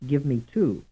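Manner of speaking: "two" stressed in "give me two"; stress on last word. Shown as a demonstration. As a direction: land on "two" at the end. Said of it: "those that are stressed like".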